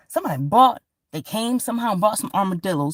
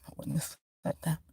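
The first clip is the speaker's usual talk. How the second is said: quiet aside; 3 semitones lower, 12 dB quieter, faster than usual. Real, not acted. Quiet aside after laugh.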